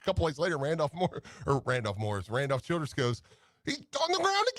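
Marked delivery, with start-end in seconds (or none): 3.6-4.6 very high-pitched mock exasperation; going off on it. Imitating commentator after another fall, high exasperated outburst. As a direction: squeal it like an incredulous commentator. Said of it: "he went off on it".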